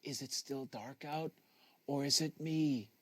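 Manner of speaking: a hint of a sleepy brother's voice from under the covers; half-asleep mumble, only lightly changed; lightly voiced drowsy Mario. Mario asking his brother Hal from bed, lightly voiced and drowsy. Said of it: "His voice came from the tangled bedding".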